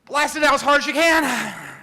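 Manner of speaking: shouted coaching command; blowing out hard. Shown as a demonstration. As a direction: yell it like a spirometry coach. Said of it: "let's everybody do it this morning".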